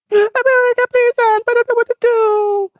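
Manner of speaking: very high, loud mock begging; hammed-up helpless wail; desperate plea. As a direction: cry out for help in a high voice. South Korea begs America for help.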